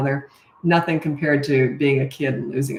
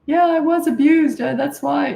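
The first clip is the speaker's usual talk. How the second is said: high, louder surprised admission; surprised client; 10 semitones higher, 4 dB louder than usual. Retold or imitated. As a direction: imitate a caught-off-guard client in a raised pitch. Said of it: "they always seem surprised".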